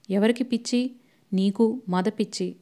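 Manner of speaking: usual speaking voice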